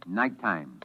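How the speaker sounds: ordinary talk, nothing marked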